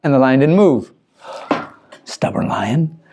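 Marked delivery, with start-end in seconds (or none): 1.5-3.0 gasp, then a slow hushed whisper; whispered aside. A narrator's reading. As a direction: lean in and breathe the words slowly. Narrator aside about stubborn lion.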